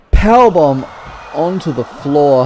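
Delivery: sudden loud, high shout of the move; excited call. Real, not acted. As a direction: belt it out as the big slam lands. Commentator calls a big move.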